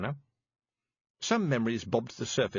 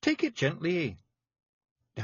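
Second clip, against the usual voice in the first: high, thick Scots pixie voice; small tough Scots fairy breaking bad news; Scottish Feegle voice; 7 semitones higher than usual. A narrator's reading. Feegle Billy.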